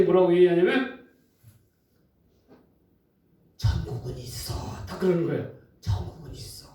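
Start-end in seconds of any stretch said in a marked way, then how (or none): 3.6-4.5 breathy whisper of a secret; hushed old woman whisper. Retold or imitated. Old woman voiced in story. 5.8-6.7 breathy whisper of a secret; hushed old woman whisper. Retold or imitated. Old woman voiced in story.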